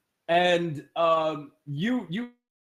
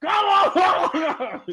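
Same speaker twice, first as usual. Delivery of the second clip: a much higher, louder "Come on!"; exasperated shout; 13 semitones higher, 6 dB louder than usual. Acted. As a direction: yell it in mock frustration. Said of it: "Charlize goes".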